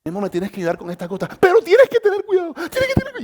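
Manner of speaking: high, loud, fast pleading wife; anxious wife. Retold or imitated. Husband imitating his nervous wife before the eye drops: high anxious pleading. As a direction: beg him to be careful, voice climbing high. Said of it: "ella me decía".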